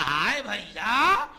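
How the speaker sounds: loud, high, drawn-out wail; wailing lament. Acted. Sister sees her poisoned brother, high wailing lament. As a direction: cry out in grief, stretching the words.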